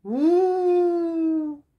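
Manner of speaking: high, drawn-out wolf howl; a teacher's mock wolf howl. Shown as a demonstration. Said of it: "La voix du loup".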